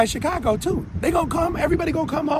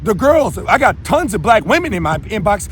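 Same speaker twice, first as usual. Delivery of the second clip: ordinary delivery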